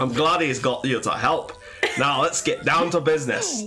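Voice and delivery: slow, badly faked Scottish accent. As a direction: labour through a terrible Scottish accent. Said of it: "I can't do a Scottish accent very well".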